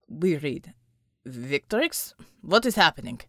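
gruff, clipped warning; low gruff character voice. Acted. Unter warning Murdoch about Nazis, low and gruff.

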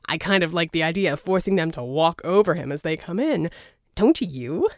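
lightly cheerful villain; breezy, pleased with himself. A narrator's reading. Verdigris proposing the body at the door, lightly cheerful. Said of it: "Verdigris said cheerfully".

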